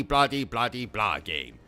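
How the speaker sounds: ordinary delivery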